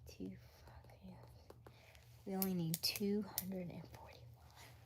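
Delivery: hushed whisper over the figures; soft whispered counting. Real, not acted. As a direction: whisper it while working it out. Whispering sums.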